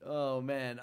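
ordinary delivery

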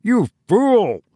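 high-pitched outburst, no real volume; a restrained scream of fury. A narrator's reading. Tussman turns on the servant, high contained scream. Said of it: "he screamed".

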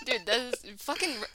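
ordinary delivery

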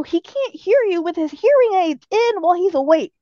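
mock exasperation at a whisperer; retold exasperated protest. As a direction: protest in disbelief, retelling it. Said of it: "I go".

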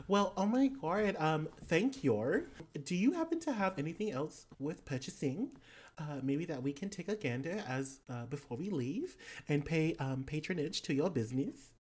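put-on lilting, hesitant Australian-accented request; player's character, polite. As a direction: ask politely in a swooping Australian lilt.

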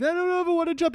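high, small, nervous whine; mock hesitation. Rider stuck atop the water slide, high mock-nervous dithering. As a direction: squeal it softly, putting off the jump.

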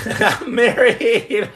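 drawn out slowly through a laugh. Real, not acted. Joking to viewers about marriage, laughing.

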